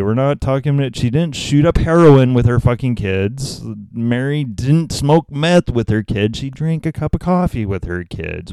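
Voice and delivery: ordinary delivery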